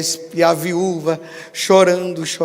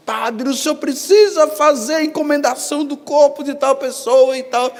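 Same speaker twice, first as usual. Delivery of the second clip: imitating pleading relatives; 10 semitones higher, 5 dB louder than usual. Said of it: "vem os parentes".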